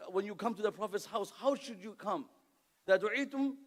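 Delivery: ordinary talk, nothing marked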